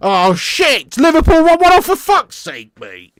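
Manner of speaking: loud, high exasperated cry; real sudden frustration at a goal; frustrated outburst. Real, not acted. Equaliser goes in.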